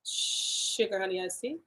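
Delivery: soft, low minced oath; hushed mock curse. Real, not acted. Niece softly cursing at the gruesome end, hushed and low. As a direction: mutter it under your breath.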